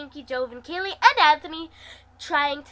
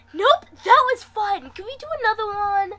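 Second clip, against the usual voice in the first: high, excited squeal; 9 semitones higher, 8 dB louder than usual. Acted. Narrator to Wes after the ride, high excited squeal. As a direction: bounce with excitement, begging for more. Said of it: "I squealed".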